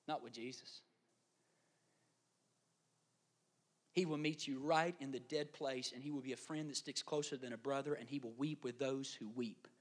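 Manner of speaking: soft, low, reflective sermon voice; hushed preaching. Real, not acted. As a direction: speak gently and low to a grieving congregation.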